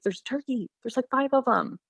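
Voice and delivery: mom voicing her son's high-pitched excited gasp; excited kid. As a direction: squeak it out like an amazed little boy. Son spotting turkeys, voiced by mom.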